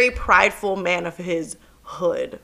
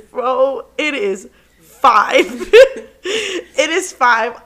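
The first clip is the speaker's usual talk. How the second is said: slow, laughing "it is five"; laughing disbelief; 3 semitones higher, 7 dB louder, much slower than usual. Real, not acted. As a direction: drag it out while cracking up. Disbelief at the late hour.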